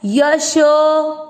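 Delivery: यशो with the शो drawn out long; यशो with a long o held; long vowel. Shown as a demonstration. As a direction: stretch the शो of यशो slowly. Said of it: "शो की मात्रा को लंबा खींचना है".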